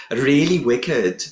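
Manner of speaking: slow Welsh lilt on wicked; Welsh accent. Retold or imitated. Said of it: "That's my Welsh accent".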